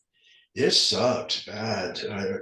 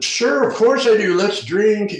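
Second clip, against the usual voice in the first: high, loud, gleeful yes; eager; 9 semitones higher, 8 dB louder than usual. Retold or imitated. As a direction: jump at the offer. Mock eager answer to offer.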